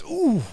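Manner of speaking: squeaky, loud ooh of a cat; high mock ooh. Cat squeals as God strokes it backwards.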